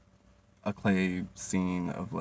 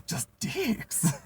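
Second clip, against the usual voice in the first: much higher squeak; mock squeaky voice; 14 semitones higher than usual. Narrator laughing about the clay, squeaky.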